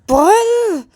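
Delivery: loud, high, drawn-out cry of a name; calling out. Acted. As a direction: wail the name to get attention. Acted calling out the partner's name. (ज़ोर से नाम पुकारना)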